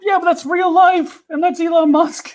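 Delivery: very high-pitched objection; incredulous protest. Real, not acted. Co-host objecting to the Elon Musk comparison, incredulous protest. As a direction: argue back with the voice shooting way up.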